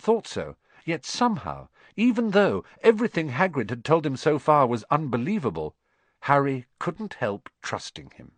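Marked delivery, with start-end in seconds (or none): none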